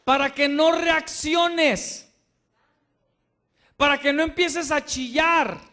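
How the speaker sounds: slow, loud barked sergeant orders; drill sergeant shout. Acted. Pastor shouting at disciples like a drill sergeant. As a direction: yell it like an army sergeant at recruits. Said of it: "Que lo grites".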